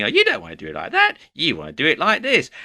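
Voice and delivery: high, quick, nasally busybody; irritating nasal voice. Acted. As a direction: butt in fast with an irritating, high nasal correction. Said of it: "this sort of irritating little nasally voice".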